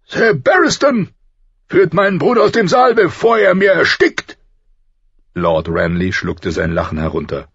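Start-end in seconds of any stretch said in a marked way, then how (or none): 0.1-4.4 high, loud furious king; fuming. Acted. The furious king orders his laughing brother out, loud and angry. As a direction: bark the order out in a rage. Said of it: "Der König schäumte".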